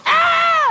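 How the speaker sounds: very high loud shriek; panicked high scream. Acted. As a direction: yell like a spooked man joining in. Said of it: "the guy over here goes".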